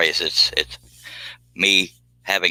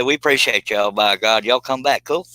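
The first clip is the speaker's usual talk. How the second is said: genuine Texan; 3 semitones higher than usual. Shown as a demonstration. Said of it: "be genuine about it".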